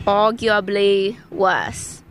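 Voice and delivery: slow, exaggerated mock British accent. Host showing non-British co-host how it's said, mock British accent. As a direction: drag it out in a put-on British accent. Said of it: "How do you say it?".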